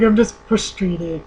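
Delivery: slow mock crying voice; imitated tearful reply. Retelling her answer to a nurse while crying, mock tearful. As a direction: draw it out as if crying, exaggerated. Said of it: "I can't talk when I'm crying".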